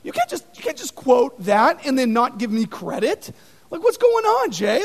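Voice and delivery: preacher imitating Buzz Lightyear upset at being quoted. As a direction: complain like an offended Buzz. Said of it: "He's like".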